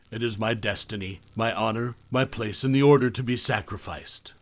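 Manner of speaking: ordinary delivery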